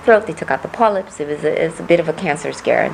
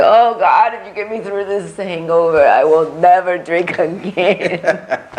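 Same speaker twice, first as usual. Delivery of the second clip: pleading hungover friend; 2 semitones higher, 3 dB louder than usual. Retold or imitated. Friends mocked in college, hungover plea to God. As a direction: ham up a friend begging God after a night out. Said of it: "they're like".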